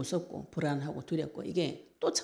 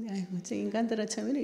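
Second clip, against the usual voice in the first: grumbling scold; 6 semitones higher, faster than usual. Retold or imitated. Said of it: "막 툴툴대고 있는데".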